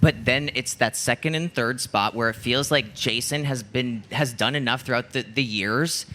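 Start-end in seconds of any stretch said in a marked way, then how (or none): none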